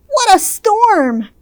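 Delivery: high chirpy bird voice; twittering wren. Acted. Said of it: "twitters wren".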